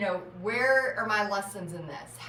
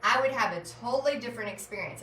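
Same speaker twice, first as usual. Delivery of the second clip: normal talking voice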